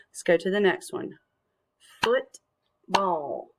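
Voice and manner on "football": FOOT, pause, BALL; syllable by syllable. Shown as a demonstration. Teacher clapping words for children, "Football" split in two. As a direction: break "Football" into two separate beats. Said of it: "clap out parts of the words".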